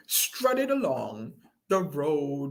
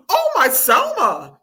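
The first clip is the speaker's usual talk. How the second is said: high, loud exclamation; astonished Granny; 10 semitones higher, 8 dB louder than usual. A narrator's reading. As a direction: cry out in amazement, high and loud. Granny amazed by appetite.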